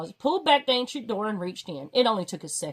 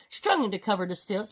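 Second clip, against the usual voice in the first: usual speaking voice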